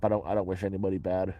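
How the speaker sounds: ordinary talk, nothing marked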